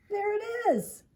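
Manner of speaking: gasp, then high, loud exclamation; sudden realization. Acted. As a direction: gasp and cry out the discovery. Drew suddenly finds the answer.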